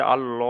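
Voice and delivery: আল-ল with a heavy, rounded "lo"; full "pur" pronunciation. Shown as a demonstration. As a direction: round the mouth on আল-ল. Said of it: "এটা হলো পুর".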